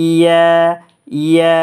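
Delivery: ইয়া kept short to one alif length. Shown as a demonstration. Teacher demonstrating a short Ya to students. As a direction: clip ইয়া to a single beat. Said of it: "এক আলিফ টান হবে".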